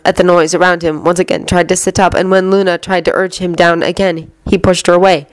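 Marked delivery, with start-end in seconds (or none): none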